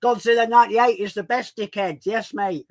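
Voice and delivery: brash Hull chav praising rubbish films; chavvy Hull accent. Acted. Streamer pitching his chav character's film reviews, Hull accent. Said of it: "so it'd be like".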